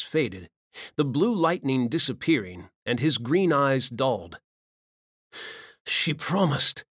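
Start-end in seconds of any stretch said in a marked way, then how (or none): 5.9-6.8 lightly voiced dying man, faint and bitter; dying demon's lament. Dying Benares to himself, a hint of faint bitter lament. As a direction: let the words fall like a last breath.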